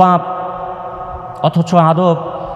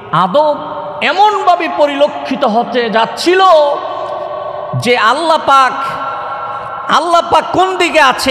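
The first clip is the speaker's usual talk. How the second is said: high preacher's cry, falling at the end; impassioned preaching; 7 semitones higher than usual. Real, not acted. Preacher drives home the Prophet's perfect manners, high impassioned preaching. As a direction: build the point in raised, intoned waves, then let it drop.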